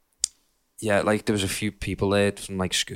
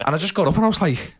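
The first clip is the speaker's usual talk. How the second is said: ordinary talk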